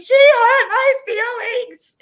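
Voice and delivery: high, loud, drawn-out crying imitation; dramatic mock wail. Mother imitating her tearful daughter, hammed-up wail. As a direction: wail it like an overdramatic child in tears. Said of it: "like, this is dramatic".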